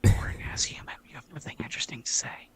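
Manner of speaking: soft, unvoiced whisper as the device; whispered insult. Smart speaker insulting its human, whispered. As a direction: whisper the put-down under your breath.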